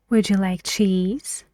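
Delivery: "cheese" lifting up at the end; rising tune on cheese. Shown as a demonstration. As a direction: let the voice climb on "cheese". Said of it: "your voice goes higher".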